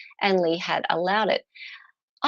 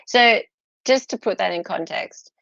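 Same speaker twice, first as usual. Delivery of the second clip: normal talking voice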